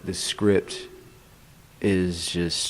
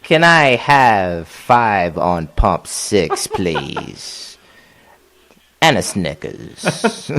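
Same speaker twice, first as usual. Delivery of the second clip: slow, loud villain voice; hammed-up villain paying for gas; 6 dB louder, much slower than usual.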